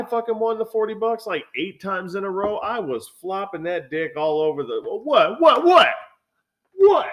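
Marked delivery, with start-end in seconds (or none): 4.8-7.1 high, loud, challenging whats; cocky taunting shouts. Retold or imitated. Acting out his cocky boasting.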